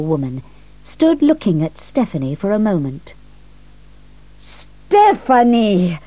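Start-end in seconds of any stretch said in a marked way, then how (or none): 4.4-6.0 slow, drawn-out happy call of her name; glad cry. A narrator's reading. Old woman greets Stephanie at the door, slow glad cry. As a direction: greet her like a long-lost friend. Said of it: "she cried in Italian".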